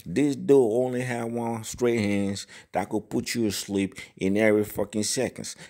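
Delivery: ordinary talk, nothing marked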